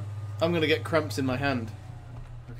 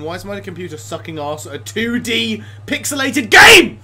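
exasperated rant to scream; 7 semitones higher, 14 dB louder than usual. Real, not acted. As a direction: start annoyed, climb to a high screamed last word. Rant at laggy computer, builds to scream.

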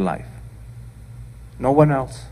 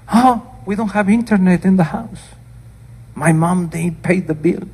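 put-on higher, louder sulky child's complaint; upset kid; 8 semitones higher, 5 dB louder than usual. Kids complaining to their aunt about no internet, higher and louder. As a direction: pitch up and whine like a grumpy kid. Voicing the kids in her story.

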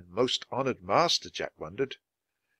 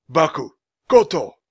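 loud, raised call of two names; shout; 5 semitones higher, 8 dB louder than usual. A narrator's reading. Old man hailing his supposed students, shouting. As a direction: shout the names across a distance. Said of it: "he heard a voice shout".

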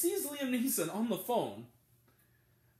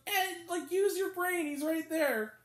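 higher, exasperated mock plea; 8 semitones higher, slower than usual. Reviewer exasperated at the dense cop, higher. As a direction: urge him to use his brain. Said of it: "'Cause it's like".

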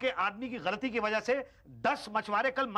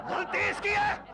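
whiny insisting; 14 semitones higher, 3 dB louder, slower than usual. Acted. Insisting it's his fault.

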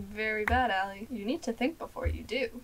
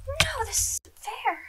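whiny; 12 semitones higher, slower than usual. Real, not acted. Whining after being chased.

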